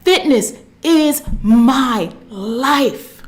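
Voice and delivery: very slow, drawn-out mantra with swelling words; passionate affirmation. Shown as a demonstration. Coach showing viewers the passion to use, passionate affirmation. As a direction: stretch each word with conviction. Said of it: "say it with that passion".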